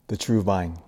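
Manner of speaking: usual speaking voice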